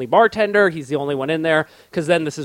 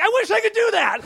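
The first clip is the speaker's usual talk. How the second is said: high shouted wish; a jealous, squeaky outburst; envious wish. Retold or imitated. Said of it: "I'm always like".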